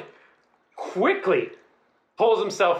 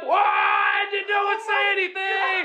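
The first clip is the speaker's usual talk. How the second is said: horrified outburst; 12 semitones higher than usual. Retold or imitated. Reacting to the roommates' revelation, horrified wail. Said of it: "And I'm horrified".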